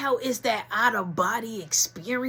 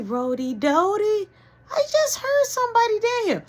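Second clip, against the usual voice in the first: high, loud call down the stairs; dad calling out, searching the house; calling out from afar; 13 semitones higher, 6 dB louder than usual. Retold or imitated. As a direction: call down into the basement.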